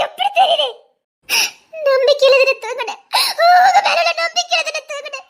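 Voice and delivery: high-pitched, crying shout; wailing reproach; tearful outburst. Acted. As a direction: cry out in hurt and betrayal. Betrayed wife's tearful confrontation.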